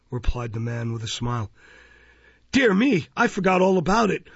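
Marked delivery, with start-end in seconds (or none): 2.5-4.3 high, quick, flustered surprise; innocent exclamation. A narrator's reading. Absent old gentleman remembering the unpaid book, innocently exclaiming. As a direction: exclaim with pitch leaping, then hurry on. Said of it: "exclaimed the absent old gentleman innocently".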